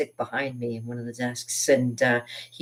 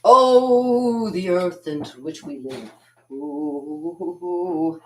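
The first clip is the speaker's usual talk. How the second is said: mock-dramatic presentation; 3 semitones higher, 5 dB louder, much slower than usual. Presenting a drawing of the earth to viewers, mock-dramatic. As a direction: open big and loud, then ease into wondrous intoning. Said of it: "it goes kinda like this".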